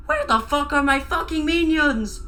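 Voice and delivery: high-pitched accented cartoon shriek; Gru shriek. A narrator's reading. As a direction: shriek the demand as an outraged villain. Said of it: "Gru shrieked".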